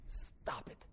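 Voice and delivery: hushed, breathy stop it; whispered command. Retold or imitated. Preacher voicing the Holy Spirit, soft whisper. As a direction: whisper it firmly. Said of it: "what the Holy Spirit said one day".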